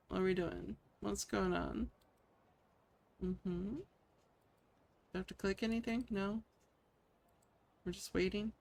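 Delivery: usual speaking voice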